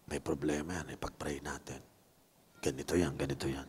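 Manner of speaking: secretive whisper to a buddy; whispered aside. Retold or imitated. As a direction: lean in and whisper it low. Friend whispering prayer plan.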